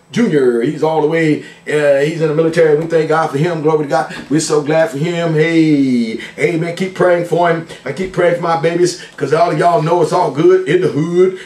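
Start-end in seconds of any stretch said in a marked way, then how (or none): none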